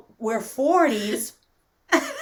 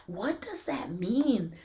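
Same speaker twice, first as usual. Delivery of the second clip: ordinary talk, nothing marked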